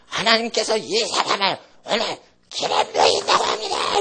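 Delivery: high, strained voice proclaiming an anointing; strained, high-pitched prophetic declaration; mock prophetic cry. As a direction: mimic a minister delivering a prophecy. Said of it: "크게 외칩니다".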